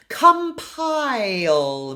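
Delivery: compile with its ah-ee vowel drawn out slowly; long diphthong. Shown as a demonstration. Coach drags out the vowel of compile for students. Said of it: "It's a diphthong".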